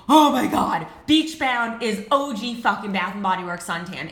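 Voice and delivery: loud, gushing oh my God; breathless fangirl shout to a friend; excited squeal. Retold or imitated. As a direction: burst out with it like you just found treasure.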